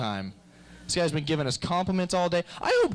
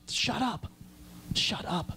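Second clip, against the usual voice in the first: mock hush; 6 semitones higher, 7 dB quieter, slower than usual. Hushing in movie theatre.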